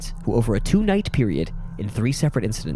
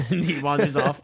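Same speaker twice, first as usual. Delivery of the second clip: usual speaking voice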